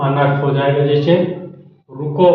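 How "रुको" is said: रुको rising, then a stop; pause after रुको. Shown as a demonstration. Teacher shows where to stop first, pause after रुको. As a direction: say रुको as its own command. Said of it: "पहले रुकिए".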